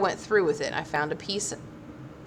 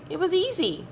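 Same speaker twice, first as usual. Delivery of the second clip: put-on child voice; 11 semitones higher than usual. A narrator's reading. Small child voice.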